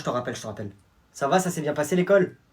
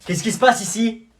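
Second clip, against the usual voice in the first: ordinary delivery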